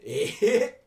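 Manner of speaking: rising doubtful eh; a Saga local doubting it; mock surprised eh. Scene: Saga viewer reacting to fake dialect, doubtful eh. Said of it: "とは思う".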